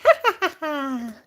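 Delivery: evil little laugh; a kitten laughing at its own plan; gloating cackle. Pretend. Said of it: "Lucky, he's taking over".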